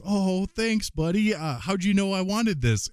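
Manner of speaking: high, polite thank-you voice; imitating his wife. Wife thanks her little son for his gift, high-pitched. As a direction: pitch it well up, like a mom receiving a kid's gift. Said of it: "she goes".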